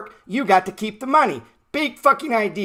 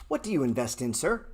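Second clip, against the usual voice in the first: polite to elders; 8 semitones lower, 9 dB quieter than usual. Shown as a demonstration. Asking a sixty-seven-year-old about his investments, softly polite. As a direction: lower and softer, courteous question. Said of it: "This is how I talk to old people".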